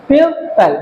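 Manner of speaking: People in two beats, পিউ then পাল; split into syllables. Shown as a demonstration. Teacher pointing out the syllables, people split as পিউ - পাল. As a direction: separate the two syllables of people with a short gap. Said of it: "দুটো syllable".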